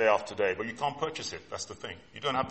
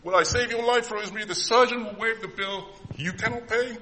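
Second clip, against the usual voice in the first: voicing God; 7 semitones higher than usual. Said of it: "God says to us".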